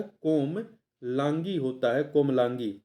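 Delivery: कोमलांगी spelled out slowly, syllable by syllable; slow it down so students catch "कोमलांगी"; slow teaching repeat. Shown as a demonstration. (धीमे स्वर में "कोमलांगी" दोहराएँ)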